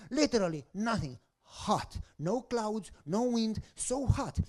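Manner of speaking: ordinary talk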